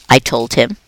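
plain reading voice

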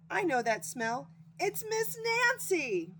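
high, loud joyful cry; excited shout. Acted. Hedgehog shouting to Sydney as he smells her, high and joyful. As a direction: cry out the name with joy, high and slow. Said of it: "shouted Taylor".

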